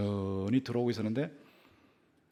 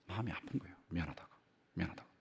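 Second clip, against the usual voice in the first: ordinary talk, nothing marked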